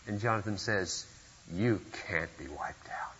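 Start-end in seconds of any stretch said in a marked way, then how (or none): 1.5-3.1 whispered, unvoiced and soft; breathy hush of a loyal friend; hushed reassurance. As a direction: lean in and whisper the promise. Jonathan's voiced reassurance.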